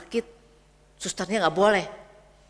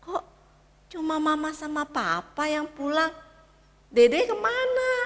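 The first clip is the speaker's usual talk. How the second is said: anak kecil bertanya; 6 semitones higher, 3 dB louder than usual. Acted. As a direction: ask like a puzzled little boy. (suara anak kecil bingung)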